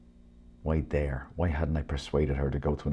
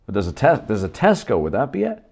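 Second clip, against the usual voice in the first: higher, louder helpful clerk; shop clerk; 5 semitones higher, 7 dB louder than usual. Acted. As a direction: offer the answer brightly. Clerk suggests Tesco.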